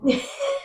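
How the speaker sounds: short high laugh; a quick giggle; burst of laughter. Real, not acted. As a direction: laugh out at the question. Laughs at Methuselah question.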